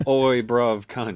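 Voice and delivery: hammed-up British geezer; British accent. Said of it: "Can you do a British accent".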